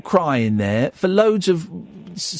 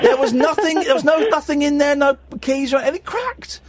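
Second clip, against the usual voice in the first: indignant protest; 8 semitones higher than usual. Real, not acted. Protesting the phone cracked by itself.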